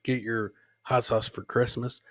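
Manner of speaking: ordinary talk, nothing marked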